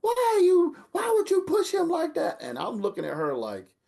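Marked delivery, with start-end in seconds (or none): 0.0-2.4 high, loud mom yelling; angry mother scolding. Retold or imitated. Mother yelling at her son for bullying the boy, high and loud. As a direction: tear into him like a furious mother. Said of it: "ready to beat the brakes off".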